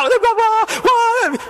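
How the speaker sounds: high, slow, drawn-out taunt. Retold or imitated. Fighter taunts his opponent, slow high taunt. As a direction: drag out the voir mockingly.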